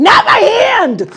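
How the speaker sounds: loud, slow, high mock outrage; mock yell. Performer mock-yelling at the volunteer. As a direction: ham up the shock, slow and loud.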